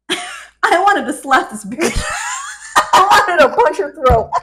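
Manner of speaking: loud laughing exasperation; laughing outburst. Real, not acted. Confessing frustration with a friend, laughing hard. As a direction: blurt it out through big laughs.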